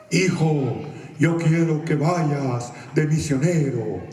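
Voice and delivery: slow, drawn-out mock divine call; voice from heaven. God calling a son to missions, slow solemn voice. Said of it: "se oiga una voz del cielo".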